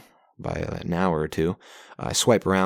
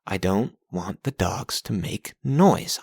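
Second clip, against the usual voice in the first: ordinary talk, nothing marked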